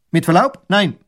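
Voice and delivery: loud, high, curt reply; military soldier. A narrator's reading. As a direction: answer smartly as a soldier. Soldier refuses in military manner.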